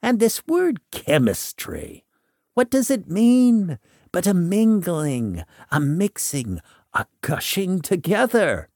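a hint of an eccentric visionary lecturing; lightly voiced mystic. A narrator's reading.

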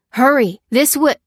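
loud, high hurrying cry; urgent call. A narrator's reading. The man leading the escape, urgent. Man urging others on during chase.

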